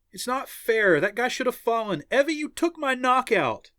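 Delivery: high, loud complaint; indignant protest. A narrator's reading. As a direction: protest the unfairness to a teammate. Teammate protests her stolen knockout.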